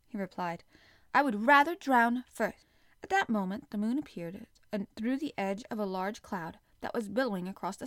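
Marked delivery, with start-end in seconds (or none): 1.1-2.7 louder, a loyal servant's flat refusal; loud, loyal defiance; fierce refusal. Acted. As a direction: refuse to leave him, raising the voice. Servant refuses to abandon master.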